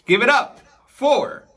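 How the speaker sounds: host drags out the guest's name for the crowd; showman announcement. Acted. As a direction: announce the tiger like a ring announcer.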